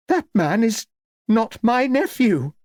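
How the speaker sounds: put-on high-pitched shocked old lady; exclaiming. A narrator's reading. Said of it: "exclaimed Lady Cleves".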